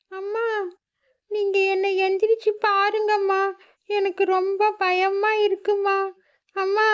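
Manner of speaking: put-on high, slow, frightened child's pleading; scared child Meenu pleading. Daughter begging her unconscious mother to get up, high scared voice. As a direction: beg her mother to wake up.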